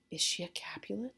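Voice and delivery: acted near-whisper of disbelief; hushed shock. Romeo realizing whose daughter she is, hushed acted shock. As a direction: drop to a soft, stunned question.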